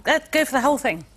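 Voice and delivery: usual speaking voice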